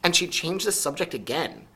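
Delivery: usual speaking voice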